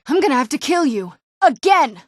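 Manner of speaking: high, loud battle taunt; combat threat. Acted. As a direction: snarl it at the enemy. Threat during a fight.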